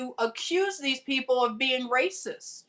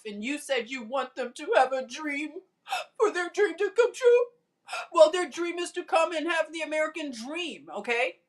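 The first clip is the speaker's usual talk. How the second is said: high, mocking sob voice, faster at the end; hammed-up weepy imitation; mock crying; 5 semitones higher, faster than usual. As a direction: whimper it like fake tears. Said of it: "Instead of crying".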